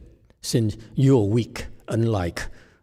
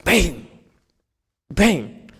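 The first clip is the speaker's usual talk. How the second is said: sharp shouted bang, high and loud; board strike sound; 9 semitones higher, 5 dB louder than usual. Shown as a demonstration. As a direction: call out the impact like a fist on a board. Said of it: "hit it against a board".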